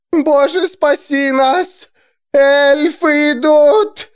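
high, loud, drawn-out shriek. A narrator's reading. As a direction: shriek it in terror. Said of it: "завизжала женщина".

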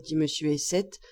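ordinary delivery

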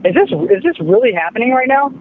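high, incredulous question; can't believe what you hear; shocked disbelief. Acted. Customer in disbelief at agent.

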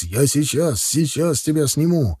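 understated alarmed father; a touch of panicked reassurance; frightened yell. A narrator's reading. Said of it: "заорал из кабины перепуганный его отец". (взволнованно, как перепуганный отец)

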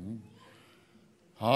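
very soft, low thinking hum; musing to oneself. Acted. Preacher acting out self-analysis, musing quietly. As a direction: hum it quietly to yourself in thought.

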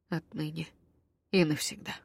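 soft, hushed vow; a quiet vow full of tenderness; doomed tenderness. A narrator's reading. Elven vow ritual.

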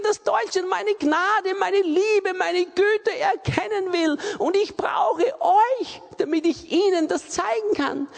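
high, loud, slow pleading as the voice of God; voicing God. Preacher giving God's heart to the church, loud pleading. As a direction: speak as God longing for his people. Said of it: "Sein Herz ist".

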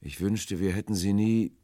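rising "wünschte", then stop dead after "nie"; high, regretful "hätten sie nie" cut short; breaking off abruptly. A narrator's reading. Stranger regrets to the sailors, stopping abruptly after "nie". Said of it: "die Stimme brach plötzlich ab".

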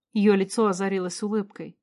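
usual speaking voice

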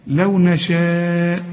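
نشاء with its aa held long; obligatory four-count madd. Shown as a demonstration. Teacher shows students the madd, long held vowel. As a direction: slowly stretch the vowel of نشاء. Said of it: "مد واجب أربع حركات".

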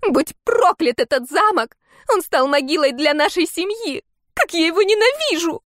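very high, loud hysterical curse; shrill screeching. Acted. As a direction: scream the curse in hatred. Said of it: "пронзительно заверещала магиня".

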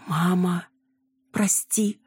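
high-pitched, dismayed apology; horrified. A narrator's reading. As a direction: apologise to mother in sudden dismay. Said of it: "ужаснулась Яна".

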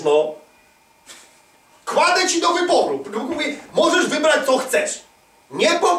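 normal talking voice

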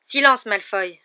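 loud, firm command. A narrator's reading. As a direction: raise the voice to silence him. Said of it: "répéta Harry".

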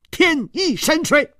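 very high, emphatic delivery; word by word. A narrator's reading. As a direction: pronounce each word pointedly, pitched high. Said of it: "一字字地接着说".

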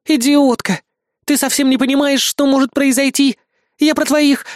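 high, agitated telling-off; angry scolding. A narrator's reading. Goddess berates the queen. (сердито, высоким голосом)

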